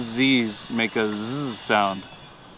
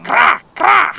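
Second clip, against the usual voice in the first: very high, loud croaked Cra, cra; Italian frog croak; 10 dB louder than usual. Shown as a demonstration. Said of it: "What is a frog in Italian?".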